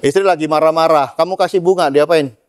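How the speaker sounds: usual speaking voice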